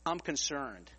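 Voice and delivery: low, quiet, deadpan warning with a laugh in it; hushed low fellow passenger breaking bad news; grave calm man. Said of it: "he looks to me and he says".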